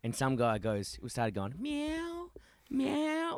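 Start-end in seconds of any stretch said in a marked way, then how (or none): 1.6-3.4 high squeaky meowing, soft; cat meow. Retold or imitated. Bloke at bottle shop catcalling, imitated high meow. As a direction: mimic a teen meowing like a cat. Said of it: "you're making a cat sound".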